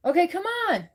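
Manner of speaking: high-pitched call to the cat; high, falling "come on"; coaxing a pet. Real, not acted. Calling the cat to food.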